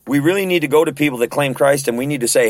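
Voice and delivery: normal talking voice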